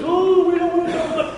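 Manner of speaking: high, whining refusal; whiny people. Retold or imitated. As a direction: whine like a scared child. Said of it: "and everybody says".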